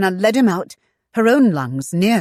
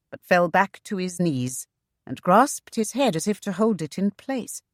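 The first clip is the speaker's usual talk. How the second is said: ordinary delivery, nothing marked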